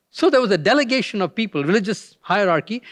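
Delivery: ordinary delivery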